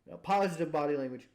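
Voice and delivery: ordinary delivery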